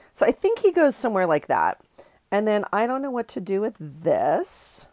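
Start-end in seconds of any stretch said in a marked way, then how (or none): none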